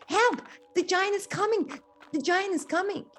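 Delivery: scared cry. Acted. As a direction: Jack fleeing the giant in panic. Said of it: "but he's very scared".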